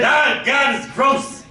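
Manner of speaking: put-on high, disgusted groan of a kid. Kid seeing his father on the bench, high mock disgust.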